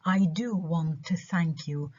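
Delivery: slow, emphatic "do"; stress on DO. Shown as a demonstration. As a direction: show keenness by stressing "do". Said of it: "أوصل لك فكرة إن أنا كتير".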